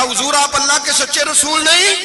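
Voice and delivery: high, pressing questions of an upset man; agitated Umar. Retold or imitated. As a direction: demand answers, worked up. Umar confronting the Prophet, retold.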